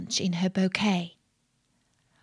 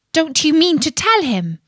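louder, higher, harsh demand; harsh questioning; 8 semitones higher, 6 dB louder than usual. A narrator's reading. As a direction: press him for an answer, harshly. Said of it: "said to him in a harsh voice".

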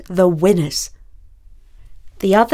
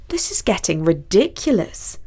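plain reading voice

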